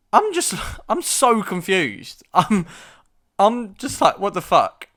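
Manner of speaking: high, loud and baffled; exasperated bewilderment. Acted. Patient baffled by a leg wrapped in bog roll, exasperated bewilderment. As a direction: cry out in total confusion. Acting out his bewilderment at nurse.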